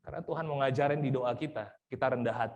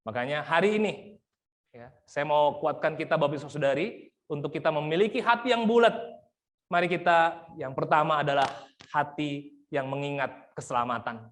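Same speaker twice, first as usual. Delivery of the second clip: impassioned preaching; 6 dB louder than usual. Acted. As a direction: hammer the point to the congregation. Preaching at full force.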